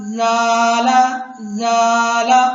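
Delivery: "ज़ाला" with a long, light open "aa"; thin alif. Shown as a demonstration. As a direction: draw out "ज़ा" without rounding in "ज़ाला". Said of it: "अलिफ को बारीक पढ़ेंगे".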